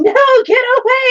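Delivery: high, loud screamed imitation of kids; imitating kids screaming at full pitch. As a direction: shriek it like children running scared. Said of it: "I can hear them screaming".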